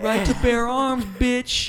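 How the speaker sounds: loud, drawn-out swaggering comeback; mock defiant shout. Founding father defending his gun, mock defiant shout. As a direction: proclaim it slowly, hammed-up and high.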